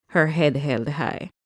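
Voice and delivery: ordinary delivery, nothing marked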